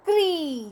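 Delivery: क्री said as a clear demo, k and ri blended; क्री pronounced. Shown as a demonstration. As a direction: sound out क्री crisply for children. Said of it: "जैसे हमने क के नीचे लगाया री". (क्री का साफ़ उच्चारण)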